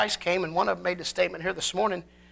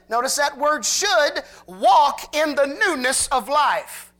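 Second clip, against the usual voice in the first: slow, emphatic scripture reading, raised and louder; preaching scripture; 5 semitones higher, 5 dB louder than usual. Acted. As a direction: preach the verses with weight. Reads scripture with force.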